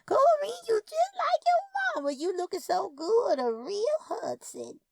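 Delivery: put-on a tiny elderly aunt delighted to see her niece; old aunt's voice. Old twin aunt greets niece.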